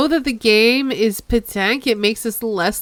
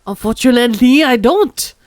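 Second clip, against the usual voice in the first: put-on loud over-the-top French accent; bad French accent; 7 dB louder than usual. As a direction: ham up the French. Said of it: "over-the-top bad French accent".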